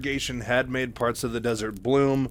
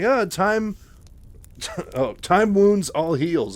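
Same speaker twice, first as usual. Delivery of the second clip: stumbling reading with a laugh; 8 semitones higher, 7 dB louder, slower than usual. Real, not acted. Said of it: "I almost read that backwards".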